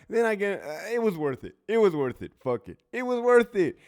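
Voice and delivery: tickled and loud, caught out by his own joke; excited, laughing. Real, not acted. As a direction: say it amused at yourself. Gleeful defiance.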